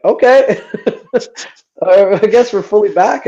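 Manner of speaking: a pleased, surprised quote with a laugh; excited, laughing disbelief. Retold or imitated. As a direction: act amazed that the band is back, laughing. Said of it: "we're like".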